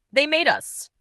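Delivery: usual speaking voice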